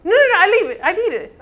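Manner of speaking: escalated mock protest. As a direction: refuse even harder, nearly shouting. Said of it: "sanguine answers again".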